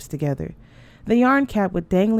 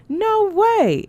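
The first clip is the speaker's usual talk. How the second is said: much higher, loud playful no; teasing refusal; 14 semitones higher, 4 dB louder than usual. A narrator's reading. Sister teasingly refusing to send the doll, high playful. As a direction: refuse with a laughing squeal.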